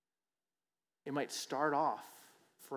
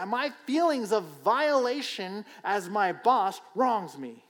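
high, loud and slow, full of grievance; entitled outrage; 8 semitones higher, 10 dB louder, slower than usual. Acted. Voicing the wronged self, high loud outraged. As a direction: swell with wounded self-importance. Preacher acts out entitled feelings.

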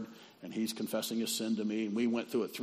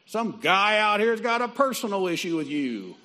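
loud high call to the back room, much higher and louder; yelling across the shop; 10 semitones higher, 9 dB louder than usual. Retold or imitated. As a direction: bellow for the boss over the counter. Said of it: "turns around and yells".

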